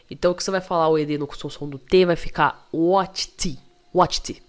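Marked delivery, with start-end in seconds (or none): 2.7-4.4 WATCHED as "watch-ti"; mistaken extra vowel. Shown as a demonstration. Teacher slips while showing the T sound, extra vowel. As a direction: let an I slip after the T of WATCHED. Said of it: "Fiz errado aqui".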